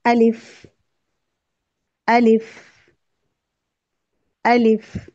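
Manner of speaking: अलिफ said clearly and loudly for repetition; clear, loud model of अलिफ; drill recitation. Shown as a demonstration. Teacher drills letter names for students, loud clear model. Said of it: "इनकी मश्क करते हैं".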